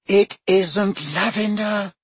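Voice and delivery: high, slow exasperated retort; tired of his hiding; impatient. Acted. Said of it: "said Hermione impatiently".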